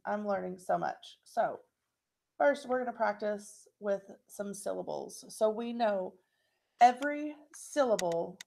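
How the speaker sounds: ordinary talk, nothing marked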